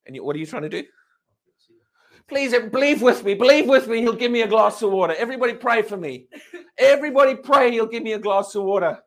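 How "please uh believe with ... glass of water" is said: louder, higher-pitched urgent appeal; hammed-up begging for everyone's prayers; mock pleading. Acted believer asking congregation to pray, hammed-up pleading.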